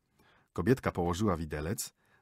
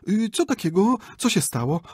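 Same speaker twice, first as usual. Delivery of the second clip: higher, louder startled asking; 1 alarmed question; 11 semitones higher, 9 dB louder than usual. Acted. A wife voicing her alarm on a mountain ride, startled and higher. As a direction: rush in, worried.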